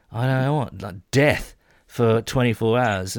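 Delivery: ordinary delivery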